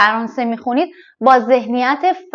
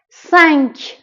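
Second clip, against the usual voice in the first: ordinary talk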